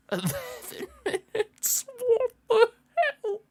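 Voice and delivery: very high, slow, crying wail; anguished disbelief. Real, not acted. Fan wailing in disbelief at eleven added minutes. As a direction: wail it like it physically hurts.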